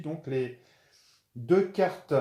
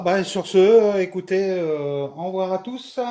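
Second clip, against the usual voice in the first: cheerful goodbye; 7 semitones higher, 10 dB louder than usual. Real, not acted. Cheerful sign-off to viewers.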